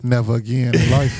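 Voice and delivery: normal talking voice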